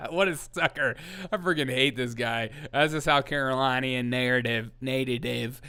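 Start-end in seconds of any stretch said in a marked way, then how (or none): none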